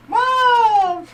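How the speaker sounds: put-on loud, very high child's cry; kid yelling mom. Kid watching his mom faint, loud high yell. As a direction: scream it like a scared kid. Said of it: "the kid's like".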